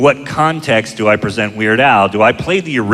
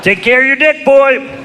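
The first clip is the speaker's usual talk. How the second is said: put-on high strained old-man voice; old dad voice; 13 semitones higher than usual. Dad answering son's phone call, crusty old-man voice.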